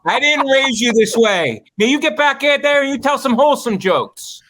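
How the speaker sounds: high-pitched stern mock parent; parental scolding. Acted parent berating an opener.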